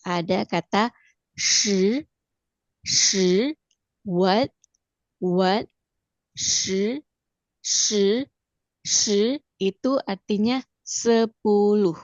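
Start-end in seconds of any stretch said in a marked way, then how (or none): 1.4-3.5 "shi" rising, with a dull e-like vowel; curled-tongue "shi" in rising tone; second-tone shi. Shown as a demonstration. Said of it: "kita pakai nada dua". 6.3-9.4 "shi" rising, with a dull e-like vowel; curled-tongue "shi" in rising tone; second-tone shi. Shown as a demonstration. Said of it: "kita pakai nada dua".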